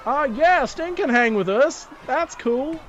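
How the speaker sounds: put-on high, loud kid voices; kids agreeing. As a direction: chime in like eager kids. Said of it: "they go".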